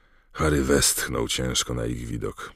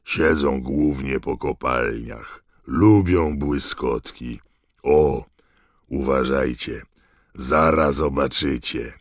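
slow, drawn-out announcement; unhurried creature keeper introducing his animals; Hagrid, very slow and deliberate; lightly voiced Hagrid; 3 dB louder, slower than usual. A narrator's reading.

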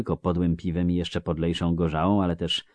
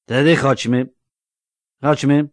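loud, higher, slurring tipsy villager; drunk Melfi; 8 semitones higher, 11 dB louder than usual. Acted. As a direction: call out like a cheery drunk. Melfi urges. (pijany Melfi, głośno i bełkotliwie)

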